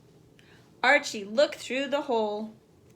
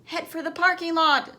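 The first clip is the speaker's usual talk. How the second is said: higher shouted order; yelled; 6 semitones higher, 4 dB louder than usual. A narrator's reading. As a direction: bark the order at the gang. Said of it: "one of the big boys yelled".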